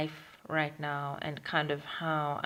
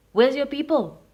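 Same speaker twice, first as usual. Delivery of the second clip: loud, raised intruder's demand; retold demand; 7 semitones higher, 9 dB louder than usual. Intruder to her in the bedroom, loud demand. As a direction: imitate a forceful demand, high and loud.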